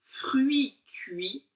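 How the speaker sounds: slowly, each word spelled out; slow demo. Shown as a demonstration. Teacher introducing a tongue twister slowly. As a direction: lay out fruits cuits slowly. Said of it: "un petit peu le même genre".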